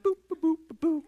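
high little boops, evenly spaced; high-pitched mock sound of someone ambling past; casual strolling boops. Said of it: "just strolling like".

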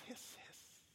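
soft voiceless snake hiss; breathy imitated hiss.

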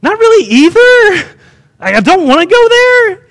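high, evasive voice; Jonah. Retold or imitated. Jonah hedges at customs about his trip, evasive. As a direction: imitate someone squirming out of a question. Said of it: "I can imagine Jonah's like".

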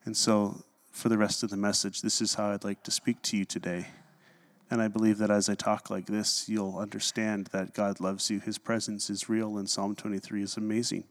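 low, soft droning monotone; boring presenter. Shown as a demonstration. Preacher mocks boring presenters in low, soft monotone. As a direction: drone on like a dull politician at a meeting. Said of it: "this is how they talk for their presentation".

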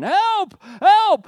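high, loud, panicked scream for help; the man in the gorilla suit shrieks for help. Retold or imitated. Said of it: "starts screaming".

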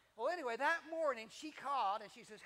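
normal talking voice

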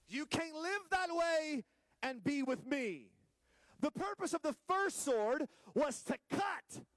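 high, slow, emphatic proclamation; forceful preaching. Real, not acted. Preacher proclaiming the first sword's purpose, high and forceful. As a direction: drive each phrase home, drawn out.

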